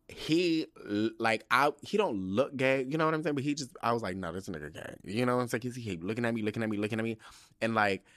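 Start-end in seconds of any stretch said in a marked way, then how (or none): 4.2-4.9 low, soft aside; quiet private conclusion; muted retold thought. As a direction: say it under the voice. First, muted take of retold thought.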